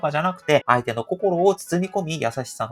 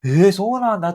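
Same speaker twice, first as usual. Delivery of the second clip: surprised reaction; 7 dB louder than usual. Shown as a demonstration. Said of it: "こういうイントネーションあるじゃないですか".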